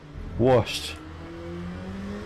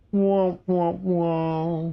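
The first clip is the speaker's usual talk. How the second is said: sad trombone wah wah; 4 semitones higher, much slower than usual. Shown as a demonstration. Sad trombone after bad lap.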